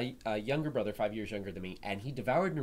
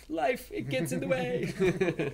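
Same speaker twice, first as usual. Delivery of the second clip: much higher, slow words through laughter; laughing; 13 semitones higher, much slower than usual. Real, not acted. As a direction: laugh your way through the excuse. Laughing apology for being away.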